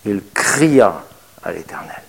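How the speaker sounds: «cria» drawn out, slow and weighty; stress on cria. Shown as a demonstration. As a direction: lean slowly into the word cria. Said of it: "il n'est pas dit qu'il pria seulement". (appuyer lentement sur «cria»)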